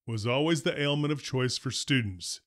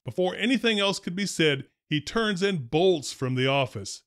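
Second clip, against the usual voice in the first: usual speaking voice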